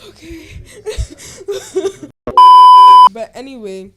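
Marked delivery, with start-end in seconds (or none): 0.5-3.1 high, loud wheezing cry-laugh; shrieking laugh. Real, not acted. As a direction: laugh until it hurts. Huge laugh after the joke.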